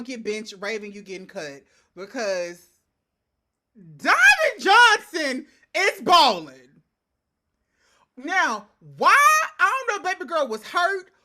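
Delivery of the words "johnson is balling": slow, louder punch on "Johnson is balling"; emphatic hype. Real, not acted. Hyping her pick to her co-host, slow and loud. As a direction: stretch and hit "Johnson is balling".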